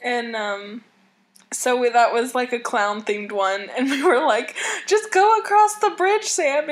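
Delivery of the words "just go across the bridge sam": high, loud coaxing call; urging call. Retold or imitated. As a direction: cheer her on across. Urging friend across the bridge.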